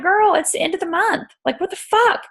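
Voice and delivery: put-on high, disbelieving girl-talk jab; incredulous retort. To Alana asking to chart at month's end, high incredulous. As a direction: toss it back in disbelief. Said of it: "I'm like".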